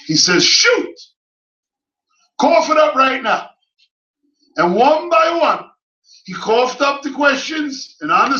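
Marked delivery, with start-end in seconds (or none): 0.4-3.5 high, loud dare to ask away; confident prophet. Acted. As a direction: challenge him to fire his questions. Jeremiah invites questions.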